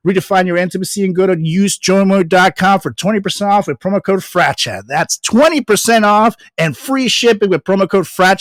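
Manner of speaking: upbeat sponsor read, selling it. Acted. Host reading a sponsor ad, upbeat promo voice. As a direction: pitch the product to the listeners like a host ad.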